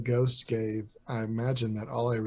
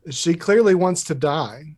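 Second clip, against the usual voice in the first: loud dry remark; 5 semitones higher, 10 dB louder than usual. Acted. Character comments on hostile enemy.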